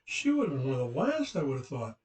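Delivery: high, swooping posh English lady, incredulous; posh English accent. Retold or imitated. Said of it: "she had this posh English accent".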